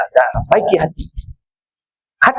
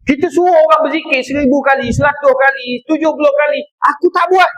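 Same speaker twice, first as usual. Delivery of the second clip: preaching at full force; 7 semitones higher than usual. Acted. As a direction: drive it home with rising fervour. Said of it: "preacher scolds himself passionately".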